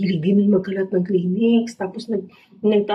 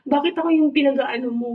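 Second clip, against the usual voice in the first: indignant cat; 7 semitones higher than usual. Retold or imitated. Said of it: "Sabi niya, sabi niya siguro".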